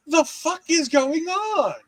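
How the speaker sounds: high, slow and incredulous; mock disbelief. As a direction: stretch it out in pretend shock. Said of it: "And I'm like".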